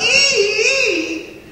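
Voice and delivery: high, squealing ee-ee cackle; Teddy's trademark squealing cackle; shrill cackling laugh. Acted. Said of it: "patented Teddy Duchamp laugh".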